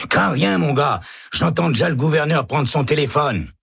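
high, eager prisoner voice; encouraging prisoner. Acted. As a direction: cheer him on from behind bars. Prisoner cheering from cell. (voix haute de détenu qui rassure)

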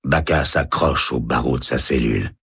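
ordinary delivery

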